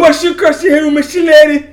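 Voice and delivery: high, shouted Irish caricature; mock Irish accent. Clowning as a shillelagh-carrying Irishman, high Irish shout. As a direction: call it out high in a fake Irish brogue.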